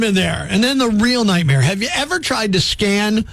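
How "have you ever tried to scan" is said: ordinary talk, nothing marked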